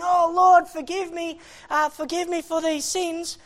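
high, urgent cry for mercy; pleading prayer. Retold or imitated. Preacher praying over old sins, high desperate pleading. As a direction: re-enact begging, bursting out loud at first. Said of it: "and I'm praying".

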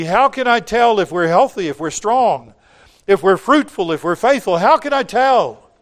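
acted pastor's urgent plea for an answer; concerned pastor. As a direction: press the questions urgently as a worried pastor. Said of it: "he was concerned about his own church".

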